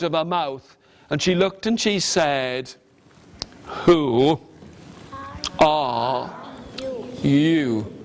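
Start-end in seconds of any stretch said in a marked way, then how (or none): 3.8-7.9 low, drawn-out regal question; like the Queen. Acted. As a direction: ask it like a grand queen. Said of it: "Just like the Queen".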